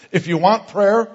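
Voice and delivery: loud, high public invitation; intercom announcement. Retold or imitated. Young man inviting shoppers over intercom, announcement voice. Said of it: "he starts calling out these words".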